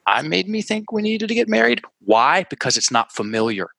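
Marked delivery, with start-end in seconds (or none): 0.1-1.8 much higher, unsure and tentative; 1 high doubtful reading. Shown as a demonstration. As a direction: coach shows how clients voice the new I sentence, high and unsure. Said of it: "they'll say it like a question a lot".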